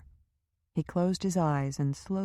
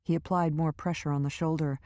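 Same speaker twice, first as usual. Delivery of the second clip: plain reading voice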